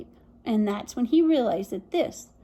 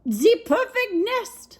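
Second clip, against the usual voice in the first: high and slow, French-flavoured delight; high excited duck; 8 semitones higher, 3 dB louder, slower than usual. Acted. Duck finding the nest, high and delighted.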